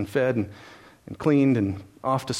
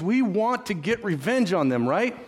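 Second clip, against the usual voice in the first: normal talking voice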